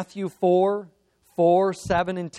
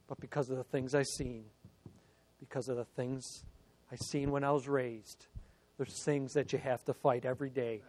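much softer, low and quick; hushed confiding; 5 semitones lower, 10 dB quieter, faster than usual. Real, not acted. Preacher confessing his morning struggle to the congregation, hushed confiding. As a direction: drop right down into a private admission.

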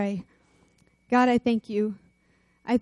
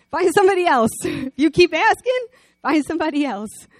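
higher, louder insistence; loud urging; 6 semitones higher, 6 dB louder than usual. Real, not acted. Preacher urging listeners to move on, loudly. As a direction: push it hard at the listeners.

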